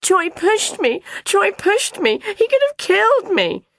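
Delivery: put-on very high, loud child's wail; high-pitched tearful tattling; piteous wail. A narrator's reading. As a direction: wail your complaint to mum. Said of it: "Morris wailed piteously".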